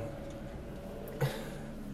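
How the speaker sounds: long exasperated sigh; frustrated sigh. Real, not acted. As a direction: breathe out all the frustration. Angry at classless fan.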